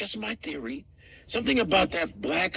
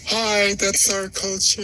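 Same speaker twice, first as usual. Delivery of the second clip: mock-polite hello to the crowd; drawn-out, put-on greeting at the end; acted awkward greeting; 3 semitones higher, slower than usual. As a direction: play the lone outsider saying hi. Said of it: "but I'm like this".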